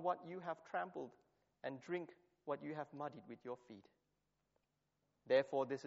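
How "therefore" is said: usual speaking voice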